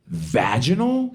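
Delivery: slow, deadpan mom voice with a laugh in it; mom's blunt question. Retold or imitated. Mom asking a stranger about her birth, slow deadpan. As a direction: drag the word out, shamelessly matter-of-fact. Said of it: "She goes".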